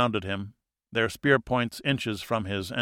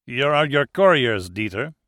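put-on a light French accent, louder and menacing; 2 semitones higher, 5 dB louder than usual. A narrator's reading. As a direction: lean on a hint of French, firm and cold. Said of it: "the man said with a French accent".

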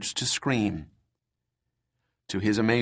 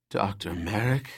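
softer, ragged voice of a hurt woman; weak injured woman; 3 semitones lower, 5 dB quieter than usual. Acted. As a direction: sound hurt and worn out. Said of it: "Her voice was ragged and weak".

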